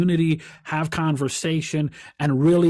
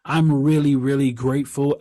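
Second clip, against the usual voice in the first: ordinary delivery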